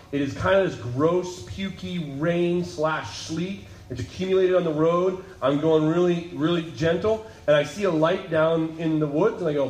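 normal talking voice

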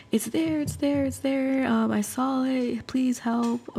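high, frightened panic, a bit hushed; scared phone call. Retold or imitated. Phoning her cousin after seeing the shape, high frightened plea. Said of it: "I'm scared".

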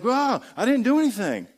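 high, indignant whine; defensive protest. Retold or imitated. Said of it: "you're like".

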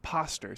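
"Poster" said softly as "POSS-ter"; misread word. Retold or imitated. Rodeo student showing classmate, "Poster" mispronounced as "POSS-ter". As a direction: point it out, saying "Poster" with a short "ah" vowel. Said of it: "He points it out".

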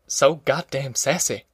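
ordinary delivery, nothing marked

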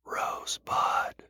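breathy dying whisper. Acted. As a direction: whisper it slowly, barely there. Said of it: "as he whispers the echoing word".

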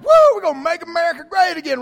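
high, loud, fast whooping cheer; mock cheering voter. Pastor mimicking election winners, loud whooping cheer. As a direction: ham up a gleeful rally chant. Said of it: "some people are like".

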